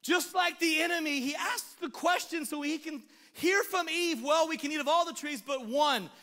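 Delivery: loud, high, driving sermon; preaching at full force. Acted. Pastor to the congregation, preaching at full force. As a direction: preach it hard to the room.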